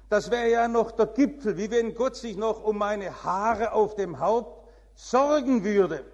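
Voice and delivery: preacher acting out an indignant churchgoer, loud and laughing; agitated. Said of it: "sehr erregt angesprochen".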